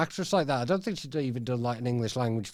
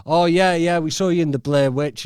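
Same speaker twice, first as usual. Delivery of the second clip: mocking casting agents; 2 semitones higher, 7 dB louder than usual. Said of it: "they were like".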